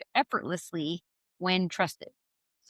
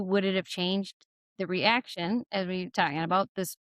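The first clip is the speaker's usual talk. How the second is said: ordinary delivery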